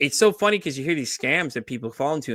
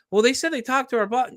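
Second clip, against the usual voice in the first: mock naive coworker; 11 semitones higher than usual. Imitating coworker on scam call.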